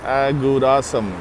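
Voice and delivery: usual speaking voice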